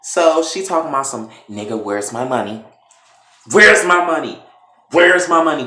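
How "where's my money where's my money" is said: loud, high shouted question; angry demand. Retold or imitated. Candace beating Brandon with a pipe, angry shout. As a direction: shout it hard, each time as a demand.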